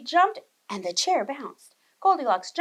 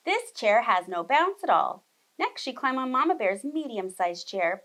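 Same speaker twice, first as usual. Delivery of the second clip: plain reading voice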